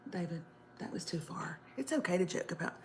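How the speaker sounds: whispered aside. Real, not acted. Host scolding co-host David in a whisper. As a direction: hush it to a whisper.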